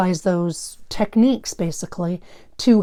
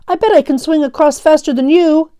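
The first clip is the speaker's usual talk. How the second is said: put-on boastful kid, higher and louder; exclaiming; 8 semitones higher, 8 dB louder than usual. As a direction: brag to your friend you're faster. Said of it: "Phil exclaimed".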